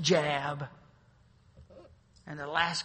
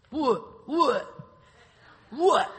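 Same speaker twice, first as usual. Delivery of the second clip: mock angry retort; 9 semitones higher, 11 dB louder, much slower than usual.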